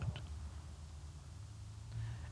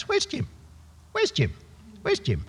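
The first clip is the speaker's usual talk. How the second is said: much higher squeaky talking-bird voice; budgie voice; 12 semitones higher, 9 dB quieter than usual. Retold or imitated. Budgie wandering the house calling for dad, high bird voice. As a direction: squawk it like a pet bird. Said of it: "the budgie would sort of wander around".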